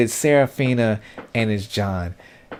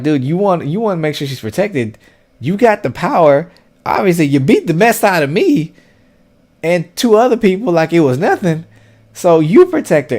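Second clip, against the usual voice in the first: higher and louder, pushing hard at a friend; heated scolding; 7 semitones higher, 5 dB louder than usual. Retold or imitated. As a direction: call out your buddy, voice raised and insistent. Voicing Arlo scolding John.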